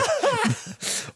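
high giggle; high laugh. Real, not acted. Laugh after robot bit.

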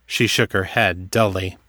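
ordinary delivery, nothing marked

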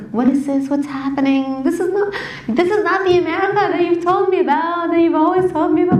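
a hint of a shaken uncle pleading on the phone; distressed uncle.

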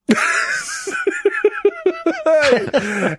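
high, drawn-out burst of laughter; long laugh. Real, not acted. Laughing at a chat room name, high laugh. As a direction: crack up helplessly, high and long.

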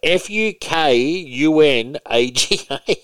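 each letter slow and separate; spelling out. Shown as a demonstration. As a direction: spell it out one letter at a time. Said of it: "Did you spell that right?".

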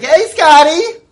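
high, loud, slow bubbly greeting; Tiffany greeting. Acted. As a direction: call out sweetly high and loud. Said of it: "She's like".

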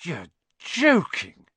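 high, loud, slow disbelief; boy stunned by news; amazed disbelief. Acted. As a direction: friend hearing big news. Ron amazed.

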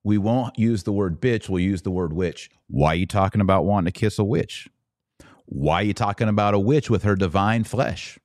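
normal talking voice

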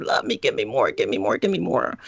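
lightly voiced clamoring child, pitched low; needy child. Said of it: "absorbing it like sponges".

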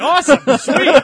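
excited cheer. Retold or imitated. Telling the lieutenant it's awesome, loud high cheer. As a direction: cheer it like you can't believe your luck. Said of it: "I'm like".

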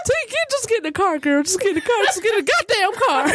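high-pitched, distraught pleading; hammed-up grieving insistence; mock frantic mother. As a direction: order them along in a high tearful voice. Weeping mother ordering her into car.

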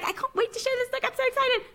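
imitating her high and soft excitement; high mock gush. Teasing her co-host, a high soft mock-excited voice. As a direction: squeal it out, half teasing. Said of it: "Lainey's like".

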